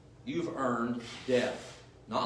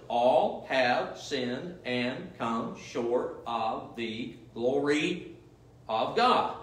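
very slow scripture quote; much slower than usual. Shown as a demonstration. Preacher mocking those who claim not to understand, very slow. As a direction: drag out "All have sinned and come short of the glory of God" word by word. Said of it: "one syllables".

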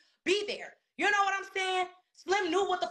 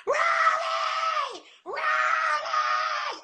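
high, loud wail of a name; imitated screaming; 12 semitones higher, 5 dB louder, much slower than usual. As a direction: scream the name out, drawn and piercing, imitating her. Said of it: "she's screaming in the house, screaming and screaming".

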